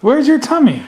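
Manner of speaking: ordinary talk, nothing marked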